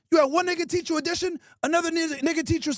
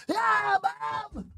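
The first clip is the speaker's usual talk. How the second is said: mock hyped kid; 7 semitones higher, 4 dB quieter than usual. Kid cheering to mom in office.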